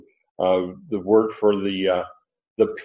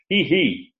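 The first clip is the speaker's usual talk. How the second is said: "He, he." repeated, the second "he" pushed higher; emphatic repeated he; 6 semitones higher than usual. Shown as a demonstration. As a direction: double the pronoun with rising weight. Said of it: "he stutters here".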